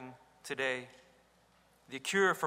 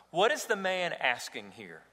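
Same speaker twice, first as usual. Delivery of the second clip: ordinary talk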